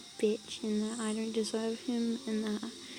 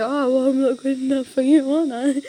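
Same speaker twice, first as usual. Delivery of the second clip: sarcastic mock voice; 2 semitones higher, 7 dB louder than usual. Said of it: "It's like".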